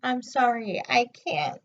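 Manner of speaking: quiet sad apology; softly sorry. A narrator's reading. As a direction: say it small, like giving up. Ricky replies sadly.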